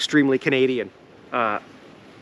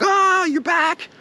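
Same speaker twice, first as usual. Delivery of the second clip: much higher, louder, slow overjoyed dog; excited dog voice; 4 dB louder, much slower than usual. Retold or imitated. The dog greeting its owner with groceries, much higher and louder, slowed down. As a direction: voice the dog greeting you at the door. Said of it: "'cause they're like".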